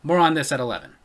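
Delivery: deep anchorman sign-off; newscaster sign-off. Pretend. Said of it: "stop talking like a newscaster".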